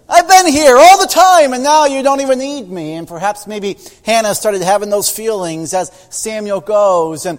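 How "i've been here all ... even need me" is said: loud, high cry of hurt; hurt outburst. Acted. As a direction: let the pain burst out. Father hurt by son.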